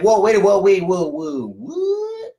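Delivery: mock "whoa, wait" double take; surprised. Host voicing a client startled at missing the invite.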